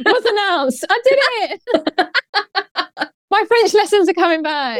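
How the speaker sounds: high, slow proud laughing; excited. Real, not acted. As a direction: squeal with pride. Proud of her French.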